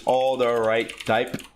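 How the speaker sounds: all the right type drawn out word by word; slow, spelled-out name. Shown as a demonstration. Explaining the program name slowly to viewers. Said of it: "I don't know if y'all know what all the right type is".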